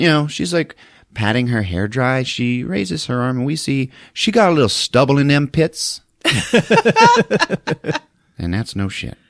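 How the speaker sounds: ordinary delivery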